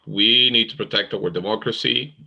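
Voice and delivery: normal talking voice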